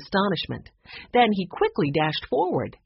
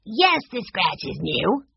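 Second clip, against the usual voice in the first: put-on firm and slow, higher boyish voice; firmly; 5 semitones higher than usual. A narrator's reading. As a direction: state it, no doubt about it. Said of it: "Benny said firmly".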